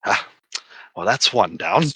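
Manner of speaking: low, dry offhand quip; casual hero quip. Acted. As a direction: toss it off coolly. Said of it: "and it's just like".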